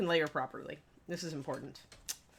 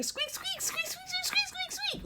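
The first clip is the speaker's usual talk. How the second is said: very high, soft squeaky dog voice; dog squeaks; 4 dB quieter, slower than usual. Pretend. Host voicing the dog Kiri to open the stream, high squeaky. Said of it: "Kiri would like to open the stream saying".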